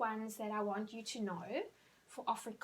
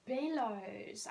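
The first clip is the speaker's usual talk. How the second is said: usual speaking voice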